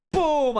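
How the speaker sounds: loud, high shouted boom; hammer-blow boom. Acted. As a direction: punch out the impact, falling in pitch. Imitates second hammer blow.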